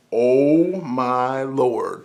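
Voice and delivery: very slow, stretched exclamation; drawn-out awe. Pretend. Fan reacting to his own movie pick, drawn-out awe. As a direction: stretch every word out in awe.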